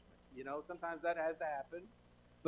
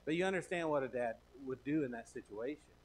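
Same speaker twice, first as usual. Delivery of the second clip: usual speaking voice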